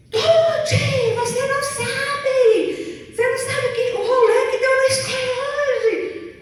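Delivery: high, loud, gushing schoolgirl voice; excited daughter yelling. Retold or imitated. Daughter coming home from school, high excited yelling. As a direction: burst in shouting the news from school.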